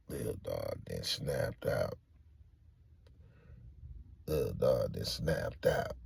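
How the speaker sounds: slow, low and hushed; subdued, heavy-paced retelling of bad news; grave disclosure. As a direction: break bad news slowly under your breath. Friend breaking bad news.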